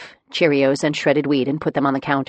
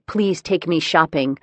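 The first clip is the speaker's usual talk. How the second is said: ordinary delivery, nothing marked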